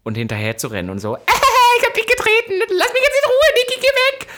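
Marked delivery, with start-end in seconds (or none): 1.2-1.7 much higher, louder burst of laughter; squealing laugh; shrieking laugh. Real, not acted. Laughing before drunk quote. 1.8-4.3 much higher, louder drunken mock yell. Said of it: "So, nach dem Motto".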